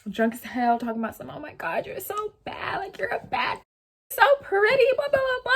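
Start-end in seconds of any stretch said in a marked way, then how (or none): 1.3-2.8 put-on breathy high whisper of a tipsy girl; drunk sister gushing. 2.8-5.5 squealing much higher and louder; tipsy girl squealing compliments; drunk sister gushing. Retold or imitated.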